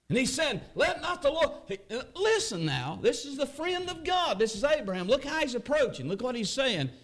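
high, fervent preacher's cry; preacher urging the church to listen; fervent preaching. Acted. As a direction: preach it with rising fervour. Preaching at full force.

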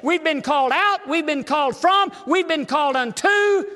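high, loud preacher's shout; full-force preaching. Real, not acted. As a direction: drive each phrase home at the top of the voice.

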